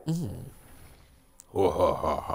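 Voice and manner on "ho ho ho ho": low mock ho-ho-ho; lightly put-on jolly Santa chuckle, pitched down; Santa-style laugh. Player jokes along with the holiday game, mock Santa laugh.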